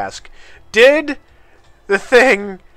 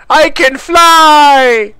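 excited; 7 semitones higher, 6 dB louder, much slower than usual. Real, not acted. Flying glitch in game.

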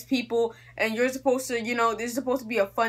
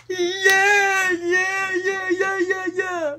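high, loud singing yeah-yeahs; hammed-up crowd chant; mock crowd cheer; 11 semitones higher, 4 dB louder, much slower than usual.

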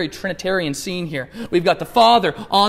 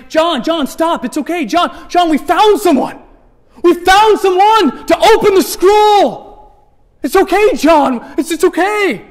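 higher and louder, urgent and slow, shouting good news; calling out to comfort; 9 semitones higher, 8 dB louder, slower than usual. Acted. Elder calling to weeping John, loud urgent reassurance. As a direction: reach him through his tears, loudly.

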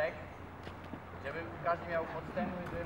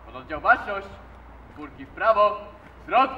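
drill command; 2 semitones higher, 9 dB louder than usual. Real, not acted.

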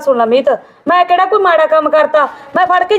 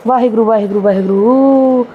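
exasperated sigh of god's name; 7 semitones lower than usual. Acted. Sister-in-law appeals to God against Meet, low weary exasperation. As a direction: drag out the last one in disbelief.

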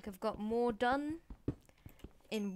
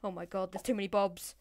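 normal talking voice

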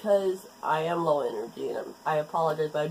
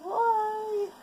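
high whining. Retold or imitated. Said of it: "I was like".

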